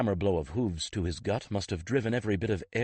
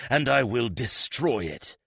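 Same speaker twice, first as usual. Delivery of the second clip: louder, higher furious threat; angry threat; 6 semitones higher, 6 dB louder than usual. Acted. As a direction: spit the threat out in rage. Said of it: "he said between clenched teeth".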